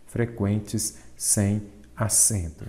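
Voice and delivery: slow, stressed Frequentes sem acento; slow answer. Shown as a demonstration. Teacher stating the correct answer to students, slow. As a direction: lay out Frequentes sem acento slowly, word by word. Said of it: "o correto seria o quê?".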